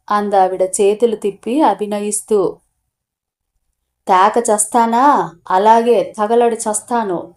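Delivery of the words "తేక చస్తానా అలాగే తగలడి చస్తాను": ordinary delivery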